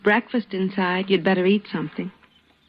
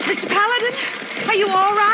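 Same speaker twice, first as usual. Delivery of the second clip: worried call; faster than usual. Acted. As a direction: call out, scared he's been hit. Doctor runs out after gunfire.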